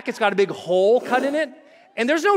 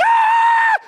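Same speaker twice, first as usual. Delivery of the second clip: loud, very high shriek; hammed-up screech of terror; chute-opening scream; 7 dB louder than usual. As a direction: scream as the cord is ripped. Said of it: "I remember I'm like".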